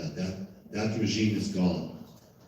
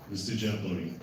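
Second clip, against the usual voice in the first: ordinary talk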